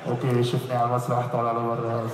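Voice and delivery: low, fast, loud heckler voice; gruff heckler. Retold or imitated. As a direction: mimic a man kicking him off stage. Imitating audience telling him off.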